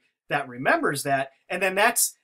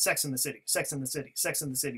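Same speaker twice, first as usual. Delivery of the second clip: quick, run-together repeats, softer; said really fast; 2 semitones lower, 7 dB quieter, faster than usual. Shown as a demonstration. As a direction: rattle it off quietly, blurring the small words. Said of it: "You say it really fast".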